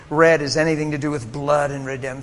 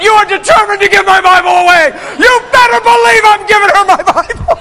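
much higher and louder hammed-up shout, laughing; mock shout at God; 14 semitones higher, 12 dB louder than usual. Preacher hamming up a shout he claims he never said to God. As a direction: yell it at God half in jest.

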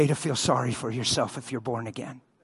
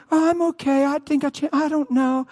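nervous lady; 9 semitones higher than usual. Retold or imitated. Afraid lady voiced.

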